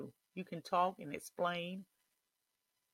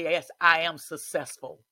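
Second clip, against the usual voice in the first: louder, defiant yes I am successful; loud declaration; 9 dB louder than usual. Shown as a demonstration. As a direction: shout it back at the devil. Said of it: "you say".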